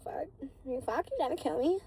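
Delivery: high, loud mock outcry; mock gasping protest. Girl protesting to her partner, high mock outcry. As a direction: playfully act out being overwhelmed.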